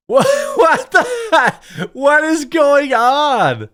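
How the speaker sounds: high, loud, incredulous, half laughing; laughing disbelief. Real, not acted. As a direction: cry out in amused shock. Reacting to absurd banana post.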